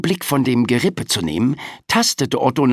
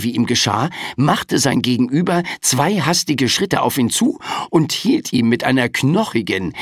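ordinary delivery, nothing marked

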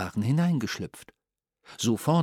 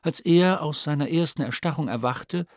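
usual speaking voice

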